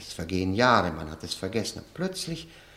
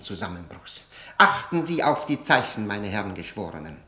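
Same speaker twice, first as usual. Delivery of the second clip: lightly mock prosecutor's plea, raised and loud at the start; quoting the prosecutor; 5 semitones higher, 3 dB louder than usual. The accused recites the prosecutor's old speech to him, as a mild courtroom mock. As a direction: recite the charge like a mocking prosecutor. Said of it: "Es gibt ein Porträt von mir".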